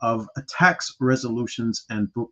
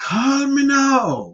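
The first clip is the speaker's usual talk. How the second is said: high, slow stretched shout; Miss Cleo impression; 11 semitones higher, much slower than usual. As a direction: belt it like a TV psychic hotline ad. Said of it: "she used to say".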